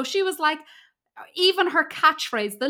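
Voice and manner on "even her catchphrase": ordinary talk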